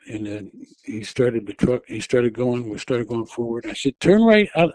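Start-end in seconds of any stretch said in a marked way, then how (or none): 4.0-4.7 high, loud retold order; urgent command. Wife ordering her husband out of the lot, raised urgent voice. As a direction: call the turn out sharply.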